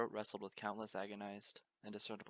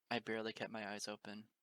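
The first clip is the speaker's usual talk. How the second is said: usual speaking voice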